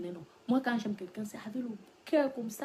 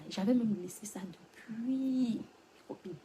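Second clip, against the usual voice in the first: ordinary talk, nothing marked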